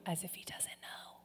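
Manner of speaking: near-whisper, dropping right away; hushed aside. Real, not acted. Preacher confides to congregation about God's question, hushed aside. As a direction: let it fall to a knowing whisper. Wry aside after quoting God.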